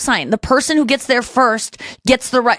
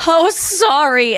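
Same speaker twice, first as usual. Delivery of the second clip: drawn-out, higher mock apology; 6 semitones higher, much slower than usual. Apologizing to a stranger for the dog, mock-apologetic. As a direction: stretch it into an over-polite oops.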